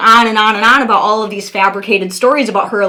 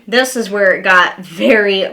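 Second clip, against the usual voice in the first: ordinary talk, nothing marked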